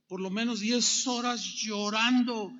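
ordinary talk, nothing marked

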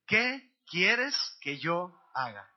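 very slow, word-by-word recitation; aloud, together. Shown as a demonstration. Preacher has congregation repeat the question, slow and aloud. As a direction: lead them through it, spacing each word. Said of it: "Dilo conmigo en voz alta".

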